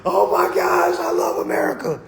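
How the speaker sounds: gleeful shout, pitch jumping up; excited shout. Real, not acted. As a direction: burst out with joy. Gushing about America.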